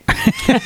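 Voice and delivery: high-pitched burst of giggling; high giggle. Real, not acted. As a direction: giggle at the mistake. Laughs at own flubbed impression.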